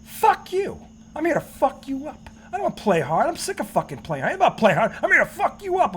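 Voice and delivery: hammed-up indignant comeback at held-back volume; imitating coach's bluster. Host mocking coach's locker-room speech to his players, petulant bluster. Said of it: "And he goes".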